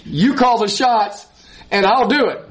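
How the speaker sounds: earnest lifted cry of yielding; fervent prayer. Acted. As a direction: plead for strength, rising up. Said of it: "we need to humble ourselves and say".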